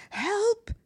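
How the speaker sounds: high, loud panicked yelp; cry for help. A narrator's reading. Bully caught in a web, crying out in panic. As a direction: shriek it like a trapped child. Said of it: "he cried".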